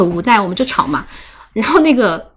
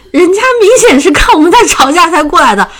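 higher, louder indignant comeback; retort in a quarrel; 8 semitones higher, 6 dB louder than usual. Retold or imitated. Narrator retorting to her brother in the bar, raised and indignant. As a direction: snap back at the brother, raised.

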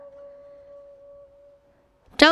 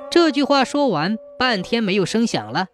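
ordinary delivery, nothing marked

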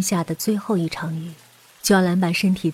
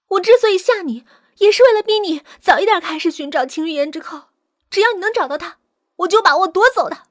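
high, pressured confession; agitated captive woman; 10 semitones higher, 4 dB louder, faster than usual. Acted. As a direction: admit the plot heatedly, voice raised. Villain confesses her scheme while captive. (激动地高声供认)

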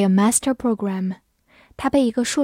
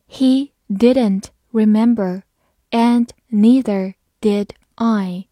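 slow, slightly louder read; 4 dB louder, slower than usual. Shown as a demonstration. Teacher models the sentence slowly for students, slow and clear. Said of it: "好，慢读一遍".

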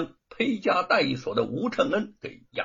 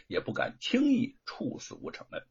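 ordinary delivery

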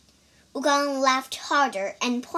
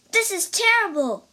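alarmed; 8 semitones higher, 5 dB louder, faster than usual. A narrator's reading. Priest discovers stolen fruit.

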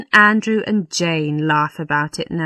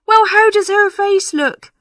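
high, louder fretful question; anxious parson; 6 dB louder than usual. Acted. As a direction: ask dreading the answer.

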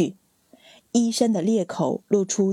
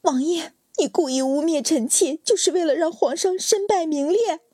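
high, disbelieving plea; incredulous question; 8 semitones higher than usual. Acted. Wife asking her husband in disbelief, high-pitched. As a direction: ask as if you cannot believe it. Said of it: "难以置信地问".

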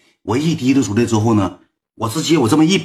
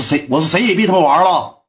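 mock yell, pitched up; retold yelling; 7 semitones higher than usual. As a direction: reenact an angry teenage outburst, voice climbing. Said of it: "我当时说话带嚷嚷了". (模仿当时嚷嚷的语气)

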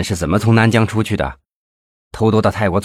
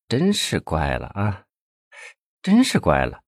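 murmuring; 6 dB quieter than usual. A narrator's reading. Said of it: "嘴里喃喃地说着".

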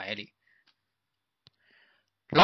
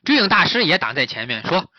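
ordinary delivery, nothing marked

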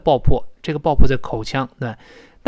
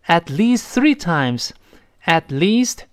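At least three times read very slowly; slow model reading; much slower than usual. Shown as a demonstration. Teacher reading the phrase slowly for students. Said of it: "注意发长音一".

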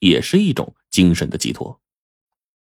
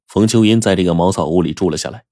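plain reading voice

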